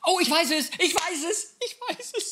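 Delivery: very high, excited squeal; real happy excitement. Real, not acted. Player suddenly knows the quiz answer, bursting with glee. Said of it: "Ich bin so glücklich".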